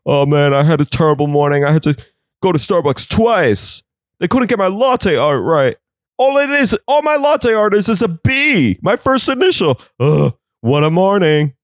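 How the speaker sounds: high, whining spoiled tech bro; high-pitched whine of a coffee-obsessed bro; whiny tech bro. Acted. Mocking a tech bro's complaints.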